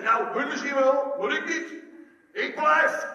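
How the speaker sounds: loud, high, cocksure declaration; boastful Peter. Retold or imitated. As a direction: play a hammed-up, overconfident disciple. Acts Peter boasting loyalty to Jesus. (overdreven stoer en zelfverzekerd)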